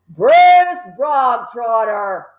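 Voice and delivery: loud slow bark of a name. A narrator's reading. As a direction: bark it out like a stern headmistress. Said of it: "the Trunchbull barked suddenly".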